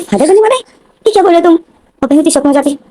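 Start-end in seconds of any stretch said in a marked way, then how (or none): 0.0-1.5 a sister who can't believe her ears; shocked disbelief. Retold or imitated. Sister hearing brother will be father, shocked high disbelief. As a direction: pitch jumps high in stunned disbelief.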